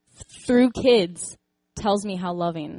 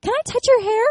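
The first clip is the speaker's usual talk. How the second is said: put-on much higher, louder child's voice; little girl asking; 7 dB louder than usual. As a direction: ask sweetly like a small girl. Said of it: "she's like".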